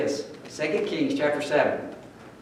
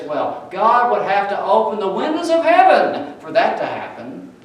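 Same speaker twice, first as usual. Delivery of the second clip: lightly voiced mocking sceptic, higher and louder; scoffing doubter; 8 semitones higher, 10 dB louder than usual. The king's officer sneering at Elisha's promise, a hint of mockery. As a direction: scoff at the prophecy as impossible. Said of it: "He laughs at this moment".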